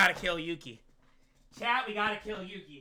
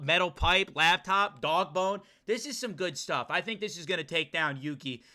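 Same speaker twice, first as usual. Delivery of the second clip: ordinary talk, nothing marked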